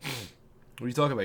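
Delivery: ordinary talk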